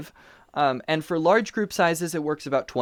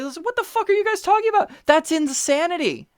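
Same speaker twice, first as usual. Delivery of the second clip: much higher, fast, hammed-up disbelief; mock outrage; 14 semitones higher, 3 dB louder than usual. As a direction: side with the kids in over-the-top shock. Mock outrage siding with kids.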